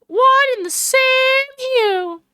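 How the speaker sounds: high, loud Southern drawl. Acted. Angry woman scolds over her wasted film, Southern drawl. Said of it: "I've given her the one with the drawl".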